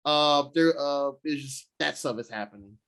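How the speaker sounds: ordinary talk, nothing marked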